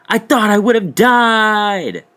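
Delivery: high, loud, slow whine; mock frightened excuse. Explaining to David why he soiled himself, high slow whine. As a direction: plead it dramatically. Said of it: "how any normal person would have responded".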